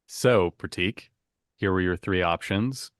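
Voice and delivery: ordinary delivery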